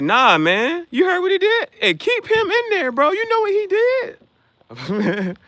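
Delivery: high, loud jeering voice; mock outraged bystander. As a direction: play a loud heckler crowing. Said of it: "They be like".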